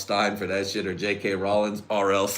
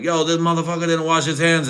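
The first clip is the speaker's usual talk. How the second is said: raised, pitched-up mock call-out; mock calling out the guy at the urinal; lightly put-on higher voice; mock outraged voice; 8 semitones higher, 5 dB louder than usual. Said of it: "I was like".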